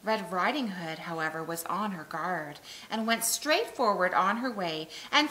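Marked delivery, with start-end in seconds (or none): none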